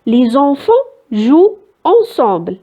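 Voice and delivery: very slow reading of the sentence; teacher reading the second sentence slowly; slow dictation. Shown as a demonstration. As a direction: read it out slowly for the class. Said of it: "الجملة الثانية".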